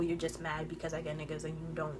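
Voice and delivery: low, soft dismissive quote; quiet, unimpressed mimicry; dismissive retelling. Retells taunt in dismissive undertone.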